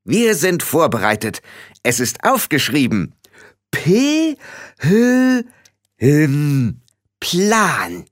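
mildly proud, spelling out high. A narrator's reading. Rob proudly shows Frau Grad his written plan, proud. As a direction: announce it proudly, letter by letter. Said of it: "sagte Rob stolz".

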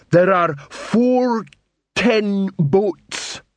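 slow, high, dim troll counting bricks. A narrator's reading.

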